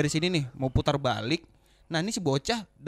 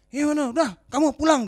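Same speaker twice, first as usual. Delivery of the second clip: imitated scolding, high and loud; angry mother; 7 semitones higher, 5 dB louder than usual. Mother sending her child home, retold angry order. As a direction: dismiss them like an irate mother. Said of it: "orang tuanya datang marah-marah".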